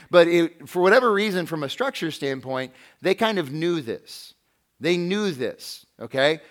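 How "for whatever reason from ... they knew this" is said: ordinary delivery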